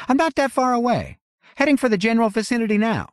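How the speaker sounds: high woman's voice, fast at the end; Winnie, rushing. A narrator's reading. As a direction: rush it out as Winnie heading over. Winnie on the phone.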